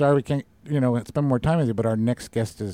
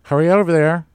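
"Hurry over there" called out much louder and higher; urging call; 5 semitones higher, 11 dB louder than usual. Real, not acted. Radio host urging listeners to hurry to the show, loud. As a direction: push the listeners out the door.

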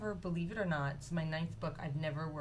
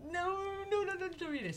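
mock panic; 13 semitones higher than usual. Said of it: "that scary feeling when you write something".

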